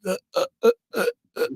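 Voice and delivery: high, stuck stammer of panic; nervous stammering. Retold or imitated. Nervous puppeteer live before Elmo, panicked high stammer. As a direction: freeze up and stutter. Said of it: "but I can't even talk".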